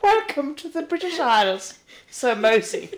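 high, slow, regal welcome; the Queen. Pretend. The Queen greets the new passport holder, regal. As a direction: pitch it up and grand like royalty. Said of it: "She's like".

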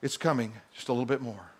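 lightly voiced God, low and hushed; God's answer. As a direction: answer softly and low, with restraint. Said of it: "You know what God says?".

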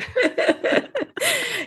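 bright high burst of laughter; a real laugh, rising high and falling away. Real, not acted. Amused by poo and bum jokes.

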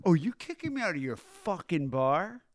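offended has-been demanding respect; indignant drunk. Retold or imitated. Drunk rocker being thrown out.

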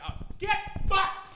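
loud, high, slow outburst; outraged yell. Retold or imitated. Yelling at the TV show, loud, high and outraged. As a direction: explode at the screen. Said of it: "I'm like".

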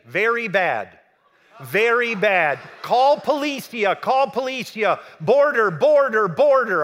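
loud, high, urgent broken-English warning; high loud alarmed shout of the words; alarmed attendant. Retold or imitated. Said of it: "He speaks no English".

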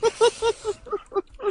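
high-pitched helpless giggle; high giggling fit. Real, not acted. Still giggling after the story, high and helpless.

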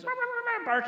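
high-pitched yapping bark noise; imitated dog barking. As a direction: imitate a little dog yipping away.

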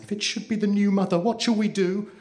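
high, hurried, urgent question; frightened stage whisper. A narrator's reading. Child asks her sister about the new mother, fearfully urgent. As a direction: fret in a high, anxious voice. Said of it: "whispered Blue Eyes".

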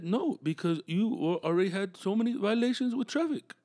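the Finnish dude asking to sit down, in a halting mock Finnish accent. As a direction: stammer like a Finn hunting for English words. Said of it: "the Finnish dude was telling me".

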